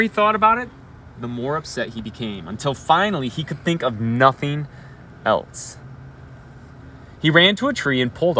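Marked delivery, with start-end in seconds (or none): none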